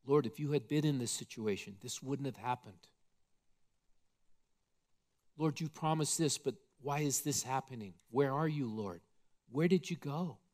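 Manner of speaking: quiet, pleading and hurt; Martha in her pain. Retold or imitated. Martha pleading softly with the Lord in her grief. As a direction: Martha begging softly, half-broken. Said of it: "in her pain".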